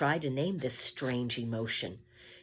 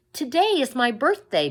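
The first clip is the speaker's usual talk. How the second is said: high, loud hopeful call; 10 semitones higher, 10 dB louder than usual. A narrator's reading. Mig calling her birthday to the royal family, high and loud. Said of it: "Mig called out".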